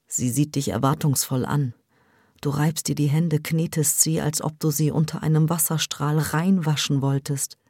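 plain reading voice

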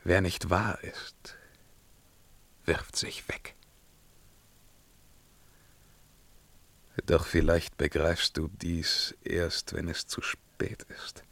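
hushed, solemn whisper; grave whisper. Acted. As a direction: lean in and breathe the words. Intimate grave counsel.

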